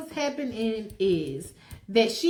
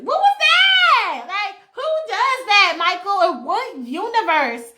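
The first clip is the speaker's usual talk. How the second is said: high, loud, slow incredulous; incredulous exclamation, much higher; outraged disbelief; 9 semitones higher, 6 dB louder, slower than usual. Real, not acted. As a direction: sound scandalized. Narrator outraged aside.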